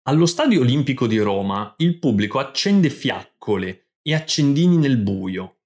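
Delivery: ordinary talk, nothing marked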